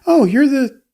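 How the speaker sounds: high, loud mock recognition; mock surprised recognition. Imagined studio exec spotting the blacklisted armorer, mock surprise. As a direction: exclaim it as if suddenly placing someone.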